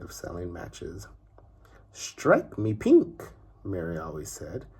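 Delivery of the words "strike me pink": high, slow, savoured exclamation; pleased nanny. Acted. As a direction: stretch it out like a delighted prim nanny.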